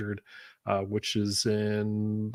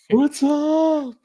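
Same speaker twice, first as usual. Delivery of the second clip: high mock voice; 7 dB louder than usual. Answers as Doug, high voice.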